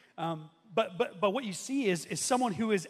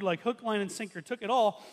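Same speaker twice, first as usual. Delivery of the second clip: ordinary talk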